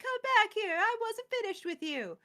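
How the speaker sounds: high, loud mock villain yell; hammed-up shout at someone running away; mock yell after her. Norn yelling after escaping Elspeth, high mock shout. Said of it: "Norn's like".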